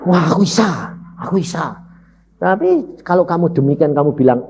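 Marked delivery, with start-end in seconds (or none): 0.0-1.8 mildly elated self-congratulation; retold beaming pride. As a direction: re-enact delighted amazement at your own ability. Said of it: "muka saya berseri-seri". (pura-pura girang dan bangga)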